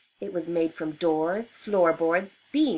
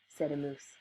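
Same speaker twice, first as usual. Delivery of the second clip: ordinary delivery, nothing marked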